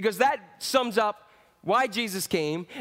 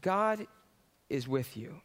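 quiet, reflective; 6 semitones lower, 6 dB quieter than usual. Shown as a demonstration. Think about that.

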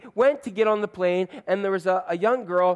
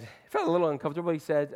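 normal talking voice

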